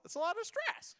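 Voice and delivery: high strained voice; mock stressed. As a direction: squeak under pressure. Contestants under chef's pressure.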